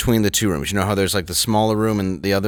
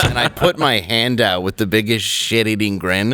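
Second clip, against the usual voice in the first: usual speaking voice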